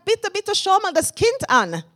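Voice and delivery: high mock plea; begging, lightly voiced; mother's plea. Mother pleading with the aunt over her baby, high. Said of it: "meine Mama sagt".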